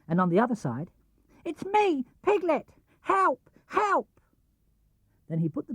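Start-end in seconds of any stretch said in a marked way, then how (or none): 1.4-4.2 very high little cry for help; a tiny voice begging to be found; squeaky desperate piglet; pleading Piglet. A narrator's reading.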